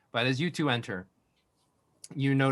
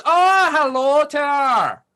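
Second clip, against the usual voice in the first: booming cheerful shout; overly loud yell; 12 semitones higher, 10 dB louder than usual. Acted. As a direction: bellow a big friendly hello. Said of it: "yells in his overly loud voice".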